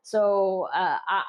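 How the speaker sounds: ordinary talk, nothing marked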